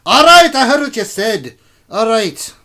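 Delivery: very loud, high, exasperated shout; exasperated dwarf king at full volume; nagged dwarf king. Acted. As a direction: snap back at a nagging whisperer. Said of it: "she's really giving it to him".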